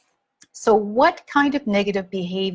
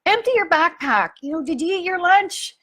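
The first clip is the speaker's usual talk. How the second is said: mock yell; 9 semitones higher than usual. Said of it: "I'd be yelling from the other end".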